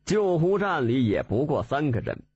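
ordinary delivery

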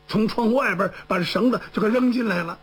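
ordinary delivery, nothing marked

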